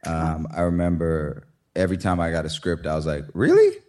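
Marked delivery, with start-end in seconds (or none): 3.3-3.8 high, loud disbelieving "Really?"; surprised. Retold or imitated. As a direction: squeak it out in shock. Retold reaction to script.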